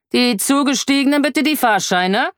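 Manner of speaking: high-pitched conductor's call; projected, official train conductor call; loud announcement. A narrator's reading. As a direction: call for tickets down the carriage. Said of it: "sagt er laut".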